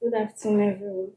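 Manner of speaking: soft, barely heard greeting; too quiet. Real, not acted. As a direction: greet the room too softly. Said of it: "Speak loud".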